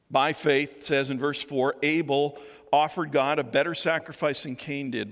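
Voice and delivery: ordinary talk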